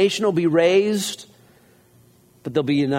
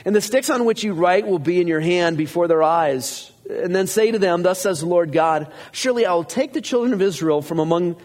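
raised, steady reading of scripture; 4 semitones higher than usual. Acted. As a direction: read it out to the congregation. Reads scripture aloud.

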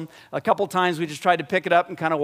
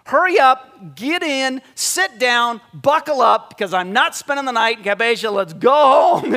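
loud, higher barking orders; mock pilot yelling orders; impatient pilot; 6 semitones higher, 7 dB louder than usual. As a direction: hurry everyone aboard. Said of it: "he flings open his door and says".

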